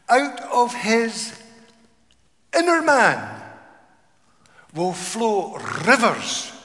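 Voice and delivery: loud, slow, soaring pulpit proclamation; fiery preacher reading Jesus's words; proclaimed scripture. Acted. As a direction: thunder the promise out.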